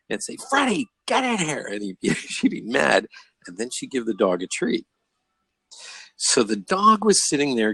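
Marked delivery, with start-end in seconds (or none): 0.5-1.7 high-pitched mock yell at a dog; imitated yell. Neighbor's wife yelling at the dog, high imitated yell. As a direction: call the dog in crossly, voice jumping way up. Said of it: "The wife would yell at the dog".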